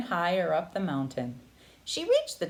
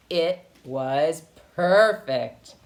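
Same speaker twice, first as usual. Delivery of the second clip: satisfied; 3 semitones lower, 7 dB louder, much slower than usual. A narrator's reading. Contented at last.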